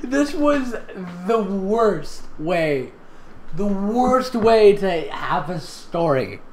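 very slow, high groaning complaint; exasperated drawl. Real, not acted. Reader groaning over the terrible story. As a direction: drag it out in despair.

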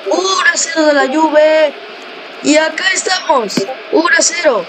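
high, shouted goal celebration; excited goal call. Acted. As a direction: yell the goal at the top of your voice. Goal scored in match commentary.

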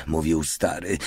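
plain reading voice

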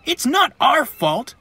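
high, loud, drawn-out protest; huffy little engine denying blame; indignant harumph. A narrator's reading. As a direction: protest slowly in a high, put-out voice. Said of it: "Ben harumphed".

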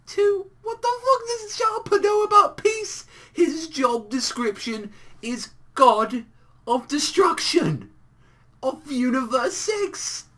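high, loud, exasperated rant; incredulous outrage. Real, not acted. Incredulous rant about Champa.

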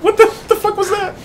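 high panicked question. Real, not acted. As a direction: gasp it out in shock. Right after a jump scare.

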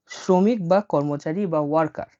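normal talking voice

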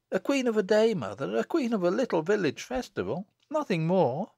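put-on high-pitched village girl protesting; reply up high as the daughter; modest daughter. Daughter playing down her role to mother, high protest.

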